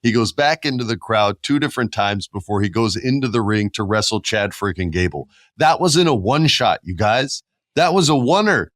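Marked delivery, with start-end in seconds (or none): 5.6-8.7 high, loud amazed rave; excited. Real, not acted. Host raves to Jeff about the oneer, excited and loud. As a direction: gush about the shot. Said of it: "excited about the oneer".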